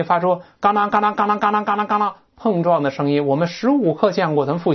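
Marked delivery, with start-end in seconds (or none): 0.6-2.1 rattling clank-clank noise; imitated clanking. Shown as a demonstration. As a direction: mimic hard things knocking together. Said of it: "硬物碰撞的声音".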